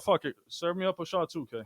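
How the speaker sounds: ordinary talk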